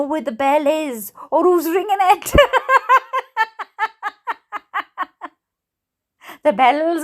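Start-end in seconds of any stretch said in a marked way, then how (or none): 2.4-5.3 high amused cackle; mocking laugh. Real, not acted. Laughing at the lawyer who never answers. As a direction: laugh it off, incredulous.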